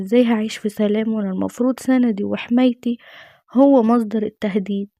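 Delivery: plain reading voice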